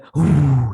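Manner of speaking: loud whooshing blow; imitated ice breath. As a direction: blow out hard like a superpower gust.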